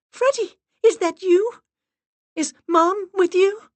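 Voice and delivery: high, loud, eager questions; excited cry. A narrator's reading. Miranda asking after dog and mother, high cry. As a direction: call out to the spirits in hope. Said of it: "Miranda cried".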